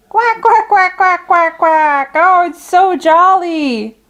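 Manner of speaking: high, happy quacking duckling; jolly duck quacking. Acted. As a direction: quack with glee like a young duck.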